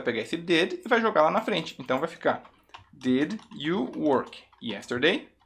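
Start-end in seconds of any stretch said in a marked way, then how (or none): 2.9-5.3 "Did, you, work, yesterday" word by word; slow careful model. Shown as a demonstration. Teacher builds the question for learners, slowly spaced. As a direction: keep "Did" and "you" slow and separate, rising at the end. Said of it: "Como fica a pronúncia?".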